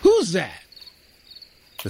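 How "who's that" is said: sudden high startled ah; surprised sergeant. A narrator's reading. Sergeant startled by a boy in the shrubs, surprised. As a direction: caught off guard by something underfoot.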